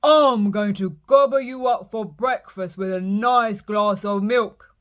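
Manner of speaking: loud troll boasting about breakfast; greedy troll. Acted. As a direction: menace the goat with relish. Troll threatens middle goat.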